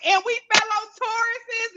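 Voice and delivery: high, overconfident sing-song claim; mocking own confidence. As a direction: play your past self, cocky and high. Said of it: "I was like".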